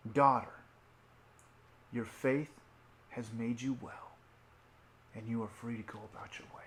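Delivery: soft, hushed blessing fading to a whisper; gentle fatherly voice to a healed woman; tender blessing. Acted.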